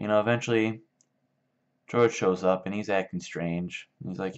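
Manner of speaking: ordinary delivery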